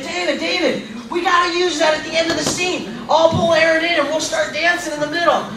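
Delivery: high-pitched, eager colleague voice; excited pitching. Retold or imitated. Terry goes over to David with an idea, excited pitching. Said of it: "Terry went over to David".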